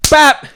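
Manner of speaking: sharp loud high bap for a punch; punch sound. Retold or imitated. Telling friends about the punch, a loud high bap. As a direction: smack the sound out like a fist landing.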